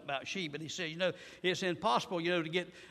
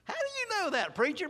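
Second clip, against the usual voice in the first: doubting listener; 13 semitones higher than usual. Acted.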